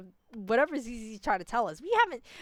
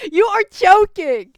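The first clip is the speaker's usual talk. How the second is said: high, drawn-out shout of disbelief; incredulous shout; 8 semitones higher, 14 dB louder, slower than usual. Real, not acted. Player yelling at the game's story, incredulous shout. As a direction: shout it slowly, utterly incredulous.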